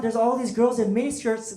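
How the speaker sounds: normal talking voice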